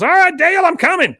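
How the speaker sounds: hammed-up shrill call to the car; calling out as Peggy. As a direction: holler it to someone outside. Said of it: "she goes".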